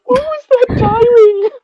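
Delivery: very high, slow, laughing cry; laughing shriek. Real, not acted. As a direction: squeal through nervous laughter. Laughing off the fright.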